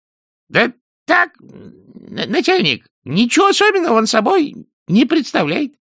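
very high, hesitant squeaky underling; stumble, then squeak out the answer; distant voice. A narrator's reading. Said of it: "Далёкий голос". (писклявый голос подчинённого, с запинкой)

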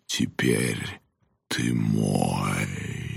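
very low, drawn-out ghoulish voice; menacing skull from a nightmare; sinister monster voice. Acted. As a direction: intone it deep and slow, claiming your prey. Skull's menacing taunt in story.

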